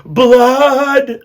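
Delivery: very high, loud drawn-out cry; rat chorus agreeing. A narrator's reading. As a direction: wail it like a whole mob of rats. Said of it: "They all agreed together".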